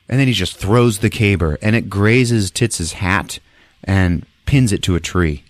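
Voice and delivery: ordinary talk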